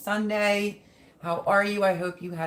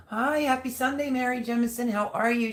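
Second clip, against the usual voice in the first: high, bright hello; cheerful greeting; 7 semitones higher than usual. Real, not acted. Greeting viewer.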